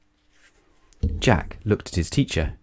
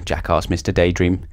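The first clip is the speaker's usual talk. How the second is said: plain reading voice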